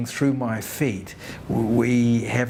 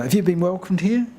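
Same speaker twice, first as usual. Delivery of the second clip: lifted, gently rising question; curious question; 8 semitones higher than usual. Retold or imitated. Asking someone born here, a raised, rising question. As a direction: ask it as a light first try, rising on here. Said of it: "And I said".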